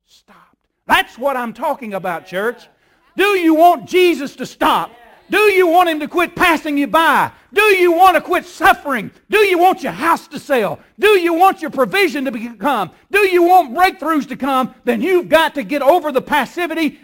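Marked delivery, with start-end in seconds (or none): 0.1-0.7 whispered. Real, not acted. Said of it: "dramatic pause, whispered". 3.2-17.0 loud, high preacher's shout; full-force preaching. Real, not acted. Pastor rousing the congregation, shouting preacher.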